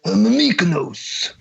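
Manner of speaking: put-on Greek accent. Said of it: "You gotta do your Michael Scott".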